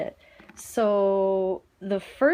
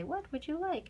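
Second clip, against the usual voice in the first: put-on higher, softer childlike chorus; teacher mimicking the students' reply, higher and soft; imitating the kids; 8 semitones higher, 6 dB quieter than usual. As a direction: echo it like a class of kids. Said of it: "the kids would say".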